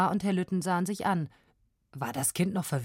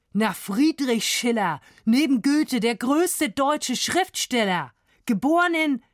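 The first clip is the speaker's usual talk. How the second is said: loud, slow, higher, climbing declamation; emphatic explanation; 6 semitones higher, 5 dB louder than usual. A narrator's reading. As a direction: proclaim it grandly, climbing until you stop short. Professor lectures, then breaks off. (nachdrücklich deklamiert, sich steigernd)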